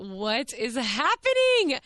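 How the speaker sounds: loud, drawn-out astonished cry; amazed disbelief. Real, not acted. Vlogger tells viewers about surprise roses, amazed disbelief.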